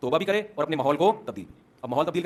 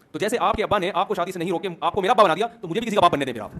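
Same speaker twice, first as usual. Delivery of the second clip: ordinary talk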